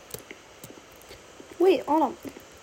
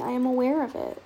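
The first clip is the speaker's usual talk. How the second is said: usual speaking voice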